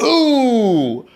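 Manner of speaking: high, drawn-out announcer exclamation; commentator catchphrase. Acted. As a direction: savor it slowly like a corny commentator. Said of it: "So I'd be like".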